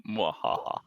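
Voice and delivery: mock villain laugh; hammed-up mwahaha; evil laugh.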